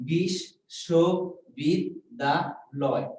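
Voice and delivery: বিশ্ববিদ্যালয় said very slowly, syllable by syllable; broken into syllables. Shown as a demonstration. Teacher splitting বিশ্ববিদ্যালয় into syllables for the class, very slowly. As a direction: pull বিশ্ববিদ্যালয় apart into slow separate syllables. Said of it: "তাহলে কি দাঁড়ালো?".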